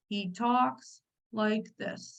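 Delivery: slow, quiet, droning delivery; boring speaker. Retold or imitated. Coach imitating a dull motivational recording, boring drone. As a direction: drag it out at half speed, softly. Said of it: "It is the most boring".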